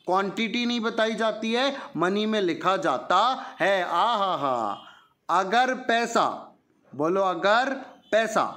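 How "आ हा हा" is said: playful aha. Acted. Teacher's playful interjection.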